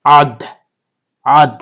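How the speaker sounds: "আদ" punched out with a bouncing final d; isolated "আদ" ending in a popped d; qalqala. Shown as a demonstration. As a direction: release the d of "আদ" with a short echo. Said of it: "কলকলা করে পড়তে হবে".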